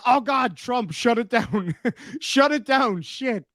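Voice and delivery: high-pitched mock alarm, laughing; mock panic. Streamer voicing YouTube shutting him down, high mock panic. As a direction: play YouTube freaking out at Trump. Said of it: "YouTube was like".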